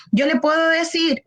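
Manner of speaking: usual speaking voice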